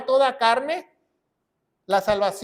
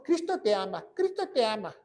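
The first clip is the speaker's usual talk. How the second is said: softer, sing-song mock reassurance; mock-gentle refrain; 6 dB quieter than usual. Preacher mimicking what the Baptist never said, mock-gentle. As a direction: imitate a soft-hearted preacher, quietly.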